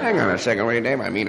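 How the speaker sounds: ordinary delivery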